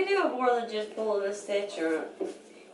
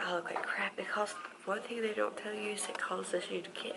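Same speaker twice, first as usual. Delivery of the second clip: whispering; 9 dB quieter than usual. Real, not acted. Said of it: "I'm pissed about it".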